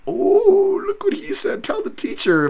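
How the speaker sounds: very high squealed schoolkid mockery; mock tattletale. Mocking a fighter as kid telling teacher, high tattletale voice. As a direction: whine it up high like a playground snitch. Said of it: "mode that he went with".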